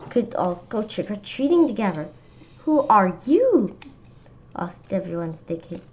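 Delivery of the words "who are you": higher, louder, slow question; curious. A narrator's reading. Kids asking each costumed friend who they are, high and slow. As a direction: ask playfully.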